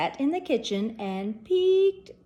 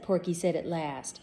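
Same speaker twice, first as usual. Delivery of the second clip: ordinary delivery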